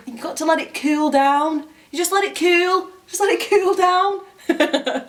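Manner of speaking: hammed-up Northern English accent breaking into laughter. Retelling the northern guy's advice to friends, exaggerated Northern English accent. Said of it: "when I was telling people that story".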